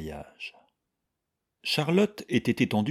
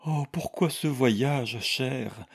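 high, slow, weary lament; plaintive; 6 semitones higher, slower than usual. A narrator's reading. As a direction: plead feebly, drawing the words out. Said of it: "dit Charlotte d'un ton plaintif".

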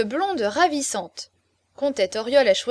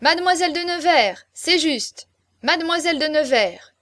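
high, raised calls; crowd echoing; 6 semitones higher, 5 dB louder than usual. A narrator's reading. Guests repeating Pérolle's correction amid laughter, high raised calls. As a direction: call it out as a merry crowd would. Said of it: "et chacun répéta".

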